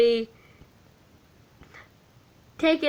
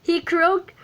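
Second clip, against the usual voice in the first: plain reading voice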